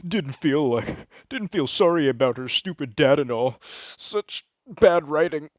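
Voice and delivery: a smug critic picking the plot apart; snobby gamer critique. Acted. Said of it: "one guy said".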